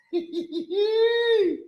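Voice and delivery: very high-pitched squealing giggle; real giggle rising into a long squeal; high laugh. Real, not acted. Host cracking up after teasing Joe, high squealing laugh.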